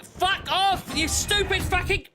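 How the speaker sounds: furious yelling at the computer; swearing tirade. Real, not acted. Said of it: "he's having a rage".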